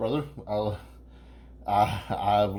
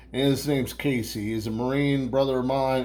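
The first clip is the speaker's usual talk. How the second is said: normal talking voice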